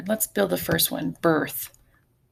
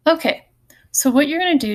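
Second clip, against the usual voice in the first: raised attention call; 8 semitones higher, 6 dB louder, faster than usual. Real, not acted. Teacher calling class to attention.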